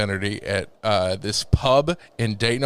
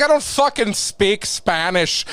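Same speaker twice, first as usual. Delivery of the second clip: frustrated complaint; 9 semitones higher, slower than usual. Retold or imitated. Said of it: "he's like".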